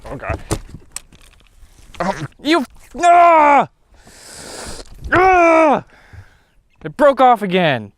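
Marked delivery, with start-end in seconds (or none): 3.0-3.7 sharp high yelp of alarm; startled yelp. Real, not acted. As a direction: cry out as bugs hit you. Startled by swarm of bugs.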